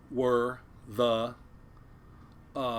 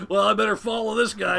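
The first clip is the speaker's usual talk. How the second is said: higher, louder acted quote; raised, punchy quote with a laugh close behind; animated crewmate quote; 9 semitones higher, 8 dB louder than usual. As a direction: blurt it out like a colleague hurrying after.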